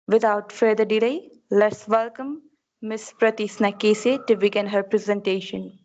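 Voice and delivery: normal talking voice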